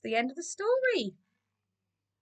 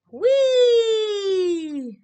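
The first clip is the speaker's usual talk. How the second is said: long, high, loud whee; gleeful cry; 9 semitones higher, 5 dB louder than usual. A narrator's reading. Narrator voicing Keith having fun, gleeful. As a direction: squeal it out in one sustained glide. Keith merrily playing before the splat.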